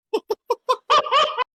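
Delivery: high, loud cackling laugh; real laughter burst. Real, not acted. Listener reacting to the shampoo story, real loud laughter.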